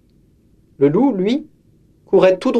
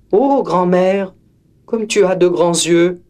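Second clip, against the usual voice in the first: put-on higher wide-eyed child; girl wondering at grandmother's ears, higher; 3 semitones higher than usual. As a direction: marvel at the big ears. Girl questions wolf.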